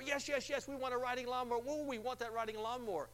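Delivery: high, breathless yes yes yes; eager caller. Retold or imitated. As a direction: rush it like a thrilled winner. Acted excited caller.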